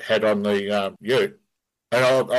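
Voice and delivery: usual speaking voice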